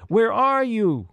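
high, stretched searching call. A narrator's reading. Peter searching for the lost elf, calling out. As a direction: call into the distance for someone missing. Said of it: "called Peter".

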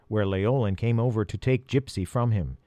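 ordinary delivery, nothing marked

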